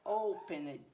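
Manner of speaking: O-pened, slow with a loud O; stress on first syllable. Shown as a demonstration. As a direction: lean hard on the O of "opened". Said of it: "a sílaba tônica não está na última". ("opened" devagar, com o O inicial forte)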